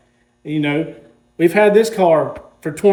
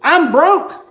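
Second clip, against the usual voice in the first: high-pitched mock despair; 11 semitones higher than usual. Explaining he just bought another car.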